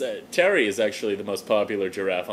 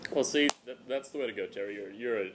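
ordinary talk

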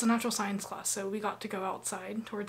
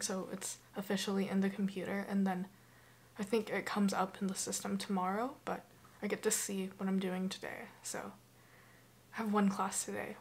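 ordinary talk

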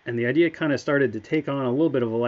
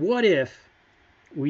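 higher, mock-eager idea opener; excited pitch; 4 semitones higher, 3 dB louder, slower than usual. Designer retells walking over to Dallas with an idea, excited. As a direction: launch an idea with a lifted voice.

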